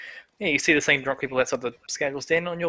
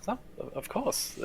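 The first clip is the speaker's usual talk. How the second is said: blushing shopkeeper; 7 semitones higher, 10 dB quieter than usual. Acted. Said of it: "She sort of blushes and says".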